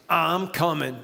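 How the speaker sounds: put-on seventies New York City smooth-talker accent; slow, cool seventies New York City pimp-style line; blaxploitation New York voice. Comedian showing his bed voice, seventies New York City accent. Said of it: "nineteen seventies blaxploitation New York City voice".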